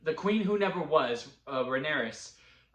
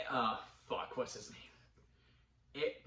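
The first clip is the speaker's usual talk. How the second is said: whispered aside, searching for a name; under the breath, trying to remember; muttered to self; 2 semitones lower, 9 dB quieter, much slower than usual. Real, not acted. Forgetting a name mid-sentence.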